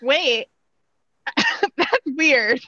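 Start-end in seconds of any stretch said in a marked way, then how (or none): none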